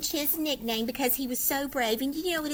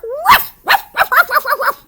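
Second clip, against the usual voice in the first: high, loud barking woofs; 14 semitones higher, 13 dB louder than usual. Acted. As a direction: bark bravely like a small dog. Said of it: "And with a loud bark".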